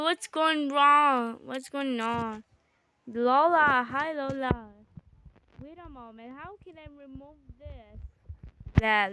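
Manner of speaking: ordinary delivery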